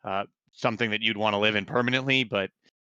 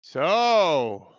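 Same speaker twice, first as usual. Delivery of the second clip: raised, higher voice called downward; calling down from above; 8 semitones higher, slower than usual. Acted. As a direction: call it down from the wall. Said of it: "he calls down".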